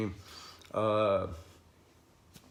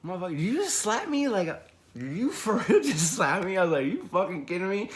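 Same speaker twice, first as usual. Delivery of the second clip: high-pitched mock outrage with a laugh; squeaky-high indignant disbelief, retold; incredulous; 8 semitones higher than usual. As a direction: react as if you can't believe the insult. Retold disbelief at being slapped.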